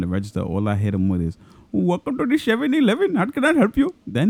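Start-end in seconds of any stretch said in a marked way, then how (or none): 1.7-3.9 much higher, exaggerated Indian accent; mock Indian accent. Clerk mimicking his boss to greet a customer, mock Indian accent.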